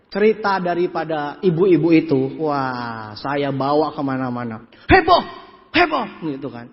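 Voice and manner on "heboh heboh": high, punchy exclamations; excited mock gossip. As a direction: cry it out like big news. Acts out the women spreading news.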